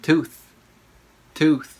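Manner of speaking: "Tooth" with a breath of th at the end. Shown as a demonstration. Teacher drills learners on TH, "Tooth" said singly. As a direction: put the tongue at the top teeth for "Tooth". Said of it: "Chữ thờ ha".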